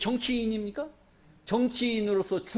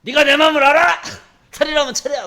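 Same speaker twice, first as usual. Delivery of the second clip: much louder, higher half-laughing shout; loud bossing; 6 semitones higher, 10 dB louder than usual. Retold or imitated. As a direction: throw the demand at her like a proud husband. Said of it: "막 큰 소리 치지 않습니까". (아내에게 큰소리치는 남편, 높고 큰 호통)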